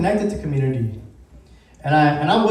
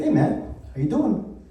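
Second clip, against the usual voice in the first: higher, lightly put-on British accent; bad British accent; 6 semitones higher, 3 dB quieter than usual. Rapper greets a fan backstage, in a bad British accent. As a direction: try a casual British greeting, badly. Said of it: "In my bad British accent".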